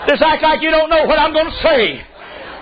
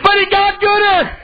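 preaching; 8 semitones higher than usual. Acted. Preaching at full force.

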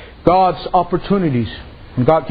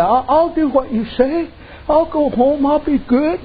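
higher, eager little promises; meek promising husband; 7 semitones higher than usual. Retold or imitated. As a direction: promise like a relieved, tamed man.

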